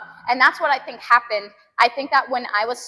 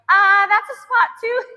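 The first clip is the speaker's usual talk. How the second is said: higher, louder pained yelp; pained groan; 8 semitones higher, 6 dB louder than usual. Retold or imitated. Rolling the massage cane on her back, a pained higher yelp. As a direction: yelp as the cane hits a sore spot. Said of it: "and I'm like".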